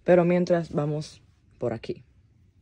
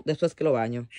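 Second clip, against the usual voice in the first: ordinary delivery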